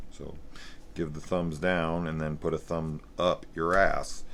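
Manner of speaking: ordinary talk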